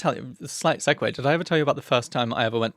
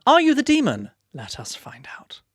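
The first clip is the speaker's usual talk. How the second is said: dramatic whisper; 8 semitones higher, 5 dB louder than usual. Acted. Dramatic game-show question then whisper.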